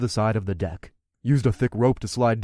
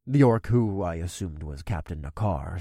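usual speaking voice

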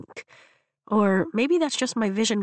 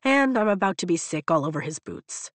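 ordinary delivery, nothing marked

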